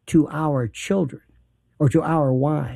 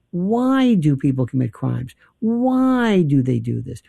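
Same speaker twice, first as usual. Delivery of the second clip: ordinary talk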